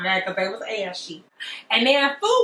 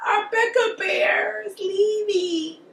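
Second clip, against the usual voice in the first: high slow mock whine; mock-wailing parents; 10 semitones higher, slower than usual. Parents mourning Rebecca leaving, a high slow mock wail. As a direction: wail it slowly like hammed-up grieving parents.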